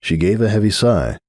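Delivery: usual speaking voice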